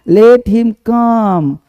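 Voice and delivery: very slow, word by word; slow dictation. Shown as a demonstration. Teacher building the sentence for students, very slowly. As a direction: lay each word down for the class to write. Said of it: "ठीक है ना, verb आना".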